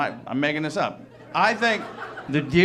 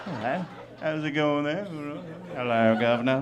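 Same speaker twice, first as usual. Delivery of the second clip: cartoon Cockney greeting; mock British accent; 4 dB quieter than usual. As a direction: greet like a stock British bloke. Imitates British bedroom talk.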